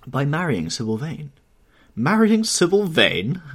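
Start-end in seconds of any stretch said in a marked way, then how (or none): 1.9-3.4 high, loud, drawn-out disbelief; amazed cry. A narrator's reading. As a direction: echo it slowly in loud, perplexed amazement. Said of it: "cried Lord Henry".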